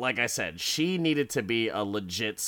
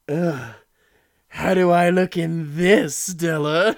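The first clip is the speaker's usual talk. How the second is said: higher, louder mock-creepy preening; creepy killer preening; 6 semitones higher, 9 dB louder than usual. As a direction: show off the coat like a creep. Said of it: "and he's like".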